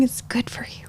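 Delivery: breathy hushed aside; whispered afterthought. Real, not acted. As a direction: drop to a whisper. Soft aside after rant.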